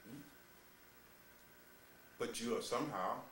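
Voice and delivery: ordinary talk, nothing marked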